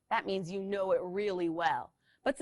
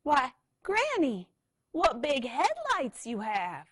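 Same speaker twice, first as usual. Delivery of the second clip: high, slow wide-eyed question; wondering little scooter; 7 semitones higher, 5 dB louder, slower than usual. Acted. Little Red asks in wonder.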